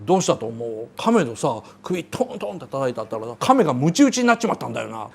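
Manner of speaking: a lightly voiced rough guy mumbling a lame joke; Rocky's corny joke. Rocky courting the pet shop girl, low and quiet. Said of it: "っていうふうに、エイドリアンに言って".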